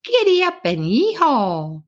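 high, swooping rodeo call at modest volume; hammed-up holler, pitched well above the narration; playful cowgirl yell. Cowgirl gallops an imaginary horse round the hangar, mock yelling.

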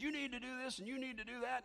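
mock scripture-quoting brother; preachy lecturing. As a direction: wag a finger at him. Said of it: "he was saying it just like that about that tempo".

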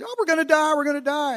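high-pitched mock panic; hammed-up falsetto fright; freaking out. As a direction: wail it like terrified men in a storm. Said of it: "like, freaking out".

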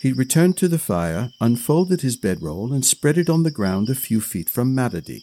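ordinary delivery, nothing marked

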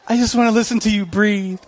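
put-on giggling lovesick teen; giggly late night call. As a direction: laugh shyly into the phone. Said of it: "on the phone".

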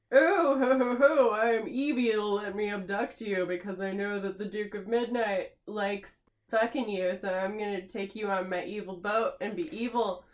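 booming hammy villain. Retold or imitated. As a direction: laugh it up like a cartoon villain. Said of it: "and he's like".

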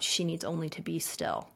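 softly breathed on the exhale; quiet scripture exhale; breathed-out prayer. Shown as a demonstration. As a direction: let the words go out with the breath, hushed. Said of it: "and then I breathe out".

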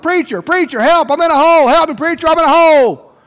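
high, loud desperate cry from a hole; yelling for help. Acted. Man stuck in a hole calls the preacher, high loud yell. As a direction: call up out of the pit. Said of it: "and he yells out".

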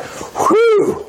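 high squeaky whew of relief; relieved whew. Retold or imitated. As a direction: sigh it out way up high. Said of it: "They're like".

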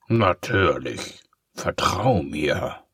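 slow, smooth false reassurance; slow fake-trustworthy villain; sly villain. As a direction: purr it like a liar.

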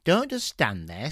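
usual speaking voice

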